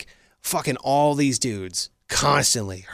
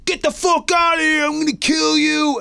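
angry stranger yelling; 11 semitones higher than usual. Retold or imitated. A knife-wielding stranger threatens Matt at midnight, high mock yell. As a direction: yell it like a crazed man banging on the door. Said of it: "I got this pound on the door".

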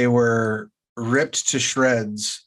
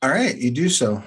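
normal talking voice

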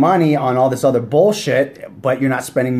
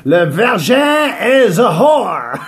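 French announcer; 11 semitones higher than usual. Pretend. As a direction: greet the audience in hammy French. Greeting in French for Montreal.

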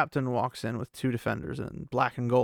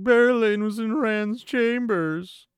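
high, loud, drawn-out fake wail; mock sobbing; 9 semitones higher, 6 dB louder, slower than usual. Elaine blurts out who was in Rand's chambers, mock sobbing. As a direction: wail it out slowly like a hammed-up sob. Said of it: "in her sobbing stage".

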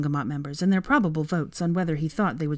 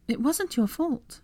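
high, slow consolation; sympathetic; 9 semitones higher, slower than usual. A narrator's reading. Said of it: "she said sympathetically".

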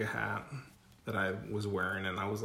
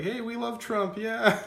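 high excited mock voice of the restaurant lady; much higher, brightly enthusiastic impression; imitating the lady; 12 semitones higher, 6 dB louder, slower than usual. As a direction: squeak it out like the cheerful lady. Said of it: "was like".

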